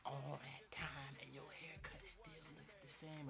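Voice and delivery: slow whispered mock complaint; mom's whispered jab. Mom grumbling in the car about the long haircut, whispered. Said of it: "She was like".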